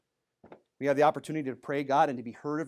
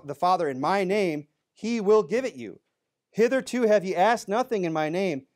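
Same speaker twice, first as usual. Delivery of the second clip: raised, ringing preacher's reading of scripture; 4 semitones higher, 4 dB louder than usual. Acted. Preacher reading John sixteen to the congregation, raised and ringing. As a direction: proclaim the verses louder and higher.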